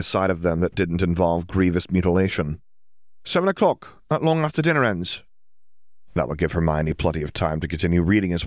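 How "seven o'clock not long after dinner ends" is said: put-on high, chirpy teasing girl; Ginny's voice.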